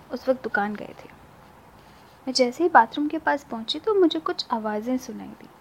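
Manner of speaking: usual speaking voice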